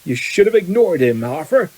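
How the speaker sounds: lightly voiced gruff giant; big gruff friend. A narrator's reading. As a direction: advise a friend with rough concern. Hagrid's gruff character voice.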